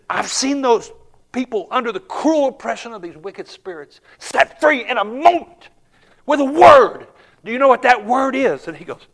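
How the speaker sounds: high, loud, building fervor; full-force preaching. Acted. Preacher tells the congregation Jesus' name frees, preaching hard. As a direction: preach it with mounting fire.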